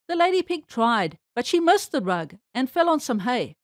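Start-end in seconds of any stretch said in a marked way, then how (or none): none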